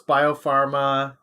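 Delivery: usual speaking voice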